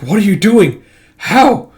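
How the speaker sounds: high, loud, incredulous demand; alarmed exclamation. Acted. A character questions a strange attack, crying out alarmed. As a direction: burst out in alarm.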